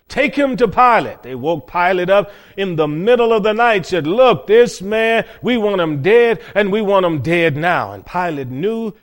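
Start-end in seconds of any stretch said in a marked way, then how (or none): 0.1-1.2 raised, high-pitched order; loud demand. Retold or imitated. Accusers handing a prisoner on to the governor, loud demand. 4.1-7.9 loud, raised, emphatic insistence; forceful demand. Retold or imitated. Accusers wake Pilate to demand an execution, forceful demand.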